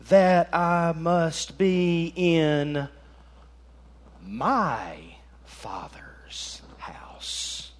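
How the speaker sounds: very slow, sinking into a whisper; hushed solemn reply. Acted. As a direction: draw each word out, fading to a whisper. Boy Jesus answers, dropping to whisper.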